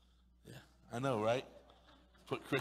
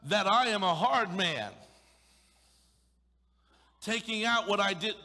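louder, higher indignant mockery; loud mock indignation; sarcastic outburst; 6 semitones higher, 9 dB louder than usual. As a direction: throw his words back at him. Master's sarcastic quote, loud.

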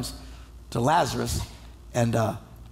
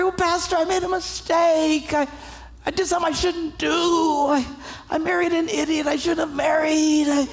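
high raised complaining voice; preacher voicing a churchgoer's excuses to the pastor; whiny excuse maker; 9 semitones higher than usual. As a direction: list excuses in a mock wail. Voicing excuses of troubled Christians.

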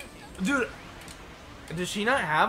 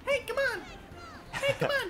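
much higher squeaky echo; high mimicry; 5 dB quieter than usual. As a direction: copy her squeak way up high. Mimicking character voice line.

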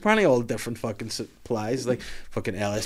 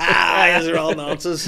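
louder, higher, dismissive mock shout; mock yell; 7 semitones higher, 7 dB louder, slower than usual. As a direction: bawl them all out for a laugh. Imagined telling off the party.

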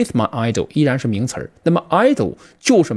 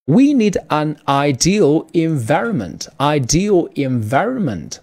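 slow, careful reading with ideal clearly stressed as eye-DEE-al; slow model sentence; slower than usual. Shown as a demonstration. Teacher models the ideal environment sentence for students, slow and clear. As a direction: read it slowly and lean on ideal. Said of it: "我们需要一个理想的环境".

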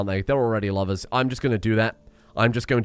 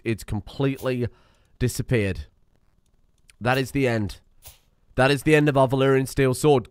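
ordinary talk

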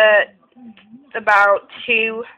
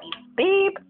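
bleeped out; 10 semitones higher than usual. Real, not acted. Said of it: "we have to bleep that out".

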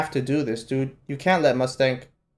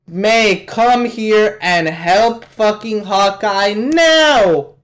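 raised, pressing yell with a long final NOW; urgent demand; 4 semitones higher, 4 dB louder, much slower than usual. Real, not acted. Viewer yelling at May to help Hawkeye, urgent demand. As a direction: order them over, higher and drawn out.

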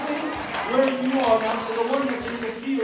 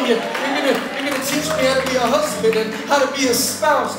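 loud, rising sermon build to a shout; preaching at full force; 6 dB louder than usual. Acted. Pastor calling people to the altar, loud build. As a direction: preach it hard, climbing to a shout.

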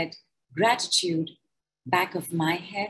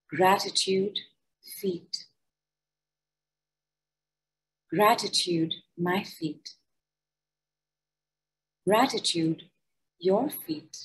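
guided gratitude meditation. Acted. Leading listeners through a body gratitude decree, calm meditation voice. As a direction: guide them gently through each body part.

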